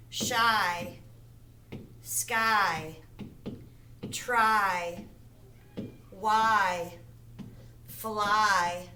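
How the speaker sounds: one word at a time with long pauses; slow, separated words. Shown as a demonstration. Teacher giving long I examples, slow and separated. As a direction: draw out each word's long I, then pause. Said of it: "That makes the long I sound".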